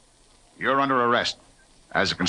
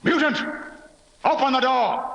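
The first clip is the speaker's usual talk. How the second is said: calling out; 12 semitones higher than usual. Acted. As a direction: shout them out of hiding. Lieutenant calls to the door.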